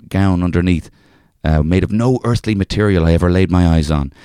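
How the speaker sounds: reading a folk tale in a lightly put-on Irish accent. Reading an old folk tale to a co-host, in a light Irish accent. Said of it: "can I just say that accent".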